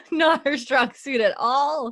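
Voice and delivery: high, loud, slow words through laughter; laughing agreement. Real, not acted. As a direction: agree while cracking up. Joins the joke, laughing.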